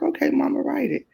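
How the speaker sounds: lifted high, breezy mock daughter; lightly voiced daughter egging her on; daughter's voice. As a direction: toss it off like a grown kid humoring mom. Daughter's reply voiced in story.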